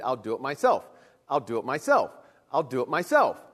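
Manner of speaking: lightly voiced storybook hen, pitched low; Little Red Hen. Preacher quotes daughter's favorite book, lightly voiced hen. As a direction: repeat it like a stubborn refrain, low.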